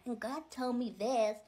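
slow, whiny mock American accent; lightly mocked American accent. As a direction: drawl it out like a whining American. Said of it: "I can't do an American accent".

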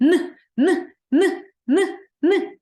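teacher isolating the first sound; sound demo. Shown as a demonstration. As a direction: sound out "Н" again and again for the children. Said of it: "Перший звук у слові нитки".

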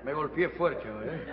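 muted aside. Acted. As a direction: confide it under the laughs. Aparte tras golpearse. (aparte grave y apagado)

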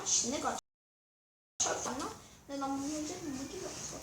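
cursing under breath. Real, not acted. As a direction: grumble to yourself quietly. Muttering after loss.

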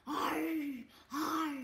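bear growl. Shown as a demonstration.

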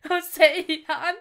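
loud laugh through the words. Real, not acted. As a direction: burst out laughing in delight. Sees surprise in the credits. (laut lachend)

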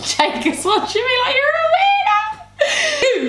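high, slow, giggling through the words; giggling about her brother watching her; laughing. Real, not acted.

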